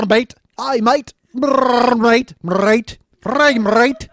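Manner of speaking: loud rolled growl into an Australian "mate"; Australian Wookiee. Shown as a demonstration. Comedian combining his Australian and his Wookiee, loud growling. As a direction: roar like a drowning Australian Wookiee. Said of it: "Maybe I combine them".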